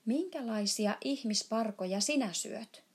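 ordinary delivery, nothing marked

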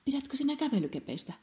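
a hint of a bright child; lightly voiced little girl. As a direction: pitch it up like an excited girl. Linda's idea, child voice.

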